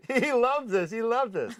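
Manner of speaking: high, loud mocking sing-song; mock gleeful pranksters. Pranksters sure Bruce loves their gag, gloating. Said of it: "And they thought".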